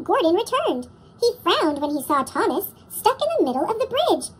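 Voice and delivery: plain reading voice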